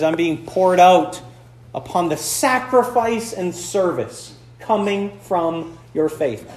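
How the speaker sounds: slowed-down reading of the verse; slow quoted verse. Shown as a demonstration. Pastor quoting Paul to the congregation, slow and deliberate. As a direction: linger on each word of the verse. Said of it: "where Paul says".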